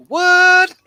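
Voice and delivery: high, loud stunned what; shocked what. Retold or imitated. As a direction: cry out in disbelief. Retold shock at hearing German.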